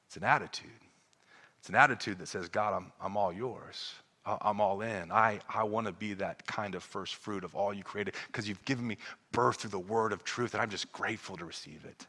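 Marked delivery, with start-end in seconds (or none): 2.5-12.0 very soft, low and humble; surrendered prayer. Acted. Believer tells God "I'm all yours", humble grateful prayer. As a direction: speak low and quietly, yielding to God.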